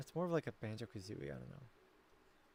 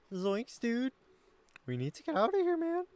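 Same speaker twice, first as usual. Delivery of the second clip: Shaggy; 9 semitones higher, 5 dB louder than usual. Shown as a demonstration. Streamer attempting a stoner hippie impression, climbing cracked-high alarm. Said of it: "trying to do Shaggy for years".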